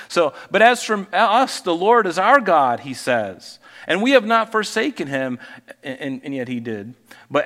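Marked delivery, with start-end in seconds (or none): 0.5-5.4 louder, higher declaiming of the verse; preacher reading a verse out to the church; forceful scripture reading. Acted. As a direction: proclaim it like a preacher.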